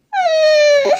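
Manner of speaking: a sudden sob-like hum; tearful whimper. Acted. Reminded of parting, starts crying. (ऊँची रोती हुई सिसकी)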